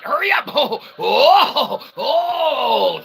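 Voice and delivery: high, shouted hearty ho-ho host riding in on horseback; jolly showman entrance. Acted. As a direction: burst in like a booming ringmaster late for his own show.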